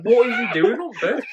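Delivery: loud, high question through giggles; laughing disbelief. Retold or imitated. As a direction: blurt it out cracking up. Retelling her incredulous laughing reaction.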